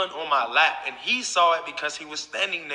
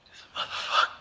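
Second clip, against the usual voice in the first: softer, breathy muttering under the breath; seething whisper; 11 dB quieter than usual. Real, not acted. To viewers, still stewing over the stranger, seething whisper.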